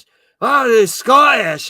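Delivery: loud, high put-on Scottish accent. Shown as a demonstration.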